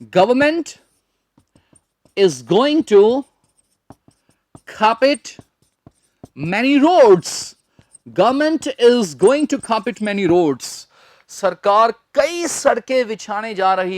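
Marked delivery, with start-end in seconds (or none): 0.0-7.5 very slow, long pauses between phrases; practice sentence. Shown as a demonstration. Teacher builds a practice sentence, slow phrase-by-phrase delivery. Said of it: "उससे practice हो जाएगी". 8.1-10.9 slow but connected, without breaks; whole practice sentence. Shown as a demonstration. Teacher repeats for practice, slow joined-up delivery. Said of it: "उससे practice हो जाएगी".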